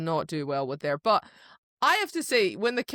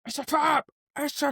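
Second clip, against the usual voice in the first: mock alarm; 7 semitones higher than usual. Hosts quoting a trap line, mock alarm. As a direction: cry it out like a famous movie warning.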